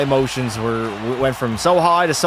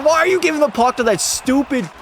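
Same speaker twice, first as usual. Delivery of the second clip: much higher, squealing disbelief; exasperated yell; 11 semitones higher than usual. Real, not acted. Goalie screaming at a teammate's giveaway, exasperated. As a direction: shriek at the bad pass.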